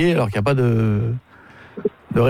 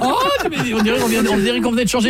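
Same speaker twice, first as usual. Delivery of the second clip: squeals of laughter; laughing high; 12 semitones higher, faster than usual. Real, not acted. Friend laughing at the bad acting, high and breathless.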